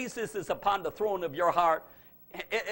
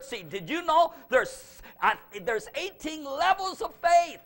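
higher, louder preacher's build; rising preaching; 5 semitones higher, 4 dB louder than usual. Acted. Preacher proclaiming the seed of faith, rising. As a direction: lift the congregation toward glory.

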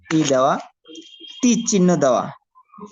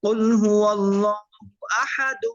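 slow melodic tajweed chant; Quran recitation; 3 semitones higher, much slower than usual. Shown as a demonstration. Teacher reciting for students in slow tajweed chant. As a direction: recite slowly, drawing out each vowel.

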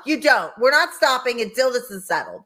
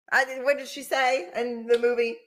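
ordinary delivery

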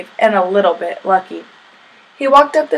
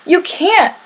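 high-pitched storybook bellow; 8 semitones higher than usual. A narrator's reading. Sammy objects to Kevin driving away, bellowing. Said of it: "bellowed Sammy".

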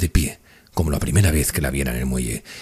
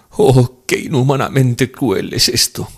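high, tearful outcry; loud wailed "oh", then bitter lament; anguished insistence; 9 semitones higher, 6 dB louder, slower than usual. A narrator's reading. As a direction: cry out at the cruelty. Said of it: "insistió ella".

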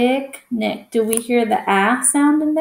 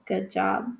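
ordinary talk

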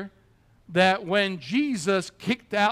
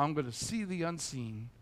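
ordinary talk

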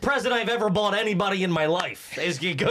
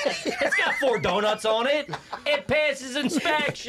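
laughing; 8 semitones higher, slower than usual. Real, not acted. Joking about his used car.

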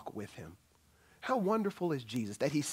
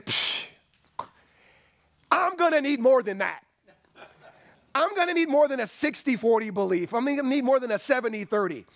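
higher and louder, scoffing and half-laughing; mock demanding Jesus; 10 semitones higher, 8 dB louder than usual. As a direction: ham up a picky Jesus who won't settle. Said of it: "why didn't Jesus say".

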